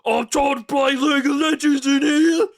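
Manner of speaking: high, very loud Cockney yell; dad yelling at the family over his game, in Cockney; shouting Cockney dad. Acted. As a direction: bellow it from the next room. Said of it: "The dad's like".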